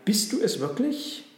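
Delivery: high, amazed question; calling out. A narrator's reading. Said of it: "riefen alle drei". (erstaunt rufend)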